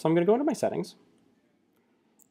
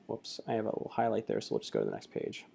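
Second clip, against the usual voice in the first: whispering to herself; quick unvoiced aside under the breath; whispered aside; 2 semitones lower, 8 dB quieter than usual. Real, not acted. Muttering aside to self.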